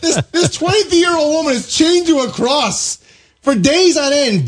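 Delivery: high, indignant ranting; outraged rant. Real, not acted. Ranting about the verdict.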